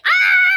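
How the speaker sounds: very high, loud shriek; re-enacted piercing yell; panicked scream. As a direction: let out an all-out scream. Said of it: "but I was screaming my".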